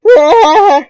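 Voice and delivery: loud, high cry of alarm; hammed-up scream; mock scream. Hit by the ice blaster, loud mock scream.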